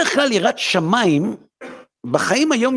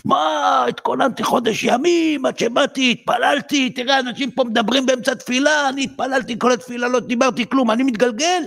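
higher, aggrieved protest; indignant complaint; 8 semitones higher than usual. Acted. Man protests to God after breaking his leg, indignant and higher. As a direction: whine your grievance at God, pitched up.

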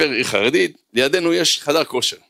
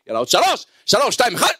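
higher-pitched push to the finish; acted urging countdown; 7 semitones higher, faster than usual. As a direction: count down urgently, pitch jumping up. Urging himself through last reps.

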